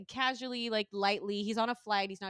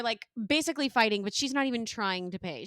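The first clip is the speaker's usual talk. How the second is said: normal talking voice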